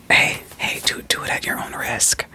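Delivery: ordinary delivery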